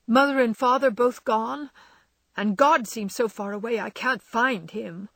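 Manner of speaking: high, loud, anguished tears; tearful pleading. Acted. Jo tells Laurie Beth is lost, tearful. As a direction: Jo pours out her despair, voice breaking. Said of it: "As the tears streamed fast".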